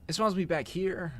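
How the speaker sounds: high, alarmed squeal; scared horror game streamer. Real, not acted. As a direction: yelp it as the monster nears. Monster nearby in horror game.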